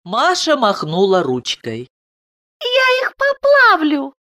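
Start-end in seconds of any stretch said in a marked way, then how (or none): 2.6-4.1 put-on very high lisping child; smiling little girl. A narrator's reading. Daughter promises papa to fix the women, smiling. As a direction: lisp it sweetly with a smile. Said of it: "сказала она с улыбкой".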